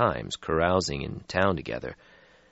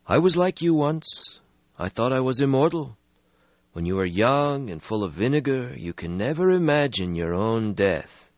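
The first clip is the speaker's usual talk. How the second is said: put-on weary old vampire; 7 semitones higher than usual. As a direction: sigh out an ancient regret. Stretsky voiced in story.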